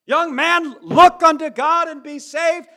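high, ringing shout of look. Retold or imitated. Elder shouting at young Spurgeon, rising to a full yell. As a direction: build to a full pulpit-pounding cry. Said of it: "louder he got".

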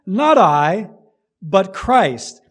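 loud "I", then "Christ" with a firm fall; emphatic contrast. Shown as a demonstration. Preacher repeating the point to a congregation, stressing "I" against "Christ". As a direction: hit "I" hard, pause, land "Christ". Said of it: "With the emphasis".

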